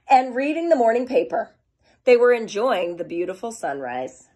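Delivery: put-on lively storybook reading for kids; reading to children. A narrator's reading. Teacher reading to her kindergarten class, lively storytelling.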